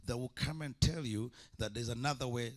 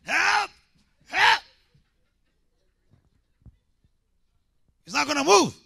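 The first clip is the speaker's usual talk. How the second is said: high, loud mock panic shout; hammed-up panic, yelling for help at a mountain; mock cry for help; 11 dB louder than usual. Mocking mountain-pushers crying help.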